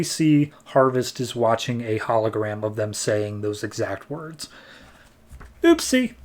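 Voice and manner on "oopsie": playful oopsie. Real, not acted. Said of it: "vlogger's playful aside".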